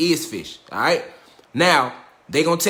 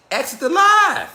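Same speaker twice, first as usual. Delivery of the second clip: exit the live; 11 semitones higher, 5 dB louder, slower than usual. Real, not acted. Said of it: "dismissing viewers".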